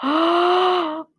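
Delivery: startled gasp into a drawn-out high "oh"; storybook shock. Acted. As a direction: gasp in shock, then hold the oh. Mouse meets the Gruffalo.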